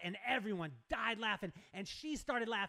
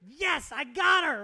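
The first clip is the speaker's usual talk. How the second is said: loud, higher victory cry, retold; triumphant cheer; 5 semitones higher, 12 dB louder, slower than usual. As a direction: crow it, stretching the yes. Retold triumph after getting her laugh.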